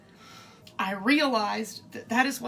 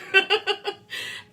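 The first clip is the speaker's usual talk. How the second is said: high giggle; laugh; 12 semitones higher, 4 dB louder than usual. Real, not acted. Cook laughing as the stew starts to move, high giggle. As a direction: burst into a short high laugh.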